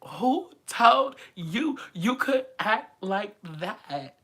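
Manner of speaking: high, slow, scolding like an offended auntie; exasperated fan scolding. Retold or imitated. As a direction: clutch your pearls and scold him. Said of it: "and I was like".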